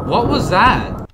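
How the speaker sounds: slow, high and loud; stunned disbelief; shocked. Real, not acted. Shaken after jump scare.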